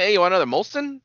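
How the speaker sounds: playful Canadian accent impression; mock Canadian waiter. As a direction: offer it like a comic Canadian waiter. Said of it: "they're all talking like".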